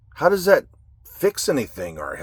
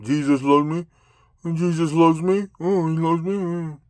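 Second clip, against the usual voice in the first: mocking singsong. Mocking a Jesus-loving believer.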